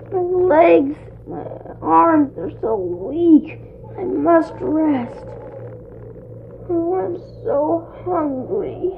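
soft, slow, drained voice; worn-out climber. Acted. Jack tires halfway up the beanstalk, weak and soft. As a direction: let the strength leave your voice.

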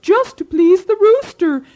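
excited owl gossip. Acted. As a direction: shriek the scandal between hoots. Neighbor owl spreading scandal.

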